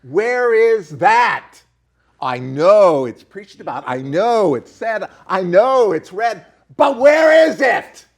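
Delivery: slow, loud preaching climax; rising impassioned oratory. Real, not acted. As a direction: thunder it like a revival preacher. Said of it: "Do I sound like a preacher there?".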